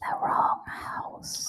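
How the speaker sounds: slow breathy whisper. Real, not acted. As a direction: whisper it slowly. Whispered side remark.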